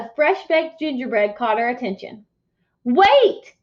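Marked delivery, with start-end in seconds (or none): 2.8-3.5 loud high call of "Wait!"; farmer shouting. Acted. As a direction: shout after the runner. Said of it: "the hungry farmer shouted".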